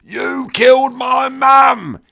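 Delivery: high, drawn-out roar of grief; loud, high, anguished bellow; cracking roar. A narrator's reading. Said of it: "he roared, voice cracking".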